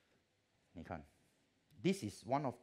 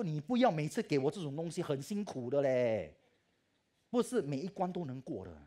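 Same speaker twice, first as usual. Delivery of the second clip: raised, half-angry complaint, louder; retold complaint to God; 4 semitones higher, 7 dB louder than usual. Complaining to God in the hospital, loud and exasperated. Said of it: "我每次跟他这样讲".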